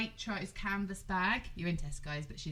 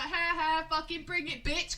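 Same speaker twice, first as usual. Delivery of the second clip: mock taunt; 7 semitones higher than usual. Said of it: "I was like".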